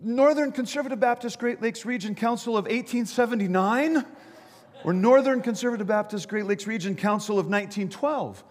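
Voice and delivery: high-pitched, insistent called-out question; mock yell up. Bystander to jumper on which council, mock yelling. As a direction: yell up both options like a quiz. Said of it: "he yelled back up".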